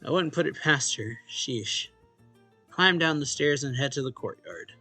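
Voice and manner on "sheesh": ordinary talk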